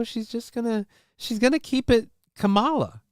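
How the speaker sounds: a hint of a high teasing tone; lightly voiced mock impression. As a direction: tease it upward, half-joking. Mock voice after keep talking like Kamala.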